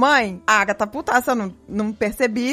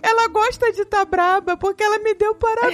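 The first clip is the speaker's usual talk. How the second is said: put-on higher pitched childlike whine; imitating a crying child; 7 semitones higher than usual. Filha chorando reclama da mãe para a tia, voz infantil chorosa. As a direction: play the confused little girl near tears. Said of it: "olhou pra mim chorando e falou".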